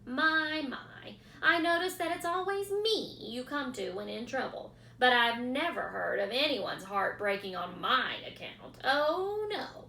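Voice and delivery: rat laughing at the pig's plea; sarcastic rat. A narrator's reading.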